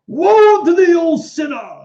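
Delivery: loud, high, drawn-out preacher's wail; hammed-up fire-and-brimstone cry; Baptist preacher. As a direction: boom it slowly like a revival preacher. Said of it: "how the Baptist preachers speak".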